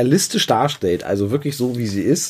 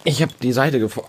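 usual speaking voice

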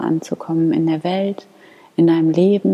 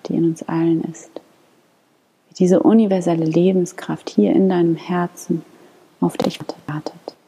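guided meditation. Acted. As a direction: lead them gently into calm prayer. Leads a guided morning prayer.

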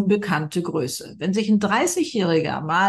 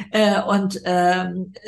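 normal talking voice

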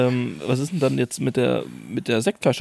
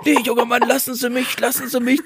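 high, fussy granny voice; squeaky old woman protesting; old lady voice; 11 semitones higher than usual. Retold or imitated. Friend mimics the old cashier.

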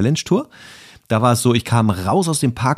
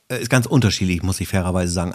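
normal talking voice

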